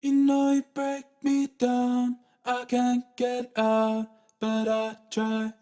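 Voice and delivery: ordinary talk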